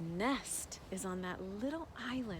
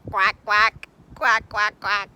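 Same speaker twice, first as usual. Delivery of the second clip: duck quack; 16 dB louder than usual. Pretend. Guide quacking like a duck for preschoolers, loud. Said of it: "What do you think the duck did say?".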